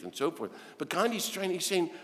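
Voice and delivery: normal talking voice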